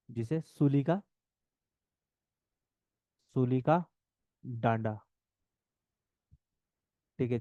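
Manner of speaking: low, measured dictation in separated chunks; unhurried low-pitched note dictation. Real, not acted. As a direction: dictate phrase by phrase, repeating for note-takers. Dictates notes to students.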